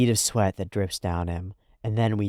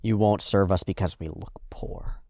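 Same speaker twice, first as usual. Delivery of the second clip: ordinary talk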